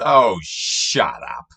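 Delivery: slow, disgusted dismissal; exasperated. Real, not acted. Reacting to the quote he just mocked, disgusted. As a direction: roll your eyes and drawl it. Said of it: "annoyed retort".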